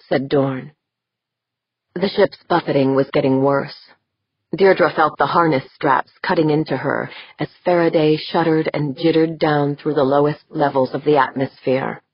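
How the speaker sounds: ordinary delivery, nothing marked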